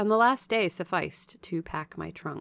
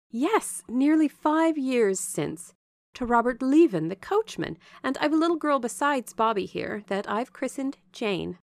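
higher, louder voice for a talkative servant; chatty Bessie, proud of her family; 6 semitones higher, 5 dB louder than usual. Acted. Bessie telling Jane about her marriage and children, chatty and proud. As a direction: answer brightly, pitched up and emphatic.

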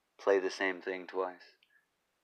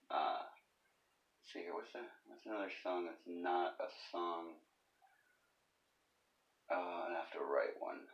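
muttering to himself; 3 semitones lower, 12 dB quieter than usual. Real, not acted. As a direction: mutter it under your breath.

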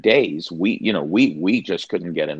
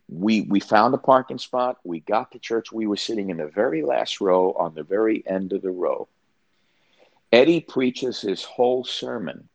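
ordinary delivery

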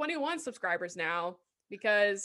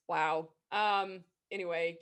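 usual speaking voice

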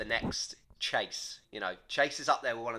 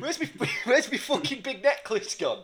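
mock mayor; 10 semitones higher, 4 dB louder than usual. Playing the mayor, laughing.

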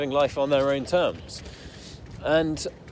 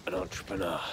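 cold fury; 8 semitones lower, 8 dB quieter than usual. Retold or imitated. Dad putting down his son's business plan, quietly furious. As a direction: imitate a furious father, hushed and deliberate. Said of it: "like furious".

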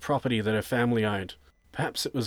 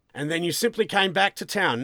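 loud, higher, disbelieving; sceptical interrogation; 7 semitones higher, 5 dB louder than usual. Acted. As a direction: press the suspect on his story.

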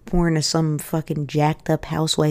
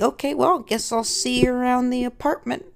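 high-pitched, put-on brave farewell; mock awkward goodbye; 8 semitones higher than usual. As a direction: act the awkwardly rejected spouse. Acted awkward goodbye after rejection.

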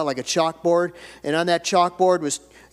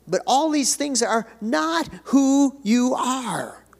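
higher, slower, louder preacher's build; rising preaching fervor; 6 semitones higher, 3 dB louder than usual. Acted. Pastor urging the church to know who they are, rising in fervor. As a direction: lift the congregation with each phrase.

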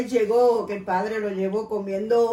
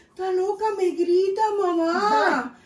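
mock child whine; 6 semitones higher, 4 dB louder, slower than usual. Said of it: "Y me decía".

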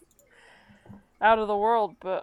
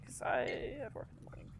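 breathy whisper, much softer; a real whispered aside about work; 2 semitones higher, 11 dB quieter than usual. Real, not acted. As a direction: slip it in as a hushed aside.

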